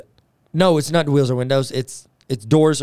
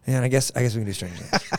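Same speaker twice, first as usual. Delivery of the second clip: quieter, grudging, faster mumble; reluctant sigh; 4 semitones lower, 7 dB quieter, faster than usual. Retold or imitated. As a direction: sound totally unenthused. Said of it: "he goes".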